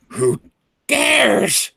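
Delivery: high, slow shrieking dragon voice; hissing reptile growl. Acted.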